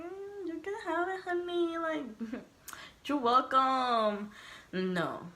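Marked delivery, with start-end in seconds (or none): 0.0-4.3 sugary sass. Retold or imitated. To the cellmates offering food, a high, syrupy fake-sweet refusal.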